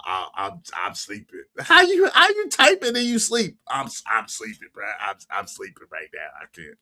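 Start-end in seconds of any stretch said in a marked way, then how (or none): 0.0-1.4 low, soft sleepy mumble; mock sleepy voice; mocking sleepy viewer. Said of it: "Tell about". 3.7-6.8 low, soft sleepy mumble; mock sleepy voice; mocking sleepy viewer. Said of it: "Tell about".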